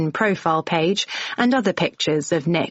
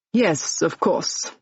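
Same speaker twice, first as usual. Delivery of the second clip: put-on soft French accent; slower than usual. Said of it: "in a soft French, possibly Belgian accent".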